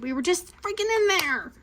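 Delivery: high, mildly exasperated complaint; retold exasperation. Mom told to go back for the prize, retold exasperation. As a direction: complain with pitch jumping up.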